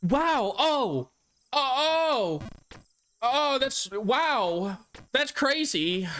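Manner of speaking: hammed-up wide-eyed oohs and aahs; gasping amazed moviegoer. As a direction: mimic a woman gasping over every scene.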